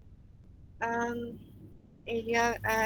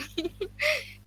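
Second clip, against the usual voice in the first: short high laugh; 11 semitones higher than usual. Real, not acted. Teasing students about intros.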